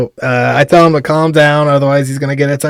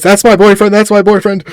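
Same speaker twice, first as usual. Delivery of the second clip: higher, louder imitated woman; excited girlfriend; 7 semitones higher, 5 dB louder than usual. Girlfriend imitated bursting in on the police, high and urgent. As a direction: blurt it out urgently, mimicking her. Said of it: "this woman appears out of nowhere and just goes".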